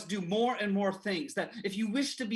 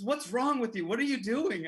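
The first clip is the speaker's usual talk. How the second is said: higher, louder annoyed demand; annoyed scolding; 6 semitones higher, 5 dB louder than usual. Retold or imitated. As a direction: snap it at the little brother. Brother scolds in the car.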